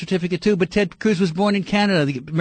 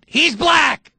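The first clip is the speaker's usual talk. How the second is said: high, exasperated yell; angry shout; 8 semitones higher, 4 dB louder than usual. Real, not acted. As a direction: shout down the objection.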